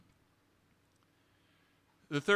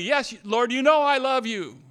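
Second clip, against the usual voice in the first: louder and higher, pressing each word; emphatic reply; 7 semitones higher, 6 dB louder than usual. Retold or imitated. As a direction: insist on it with all your heart. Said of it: "Peter says emphatically".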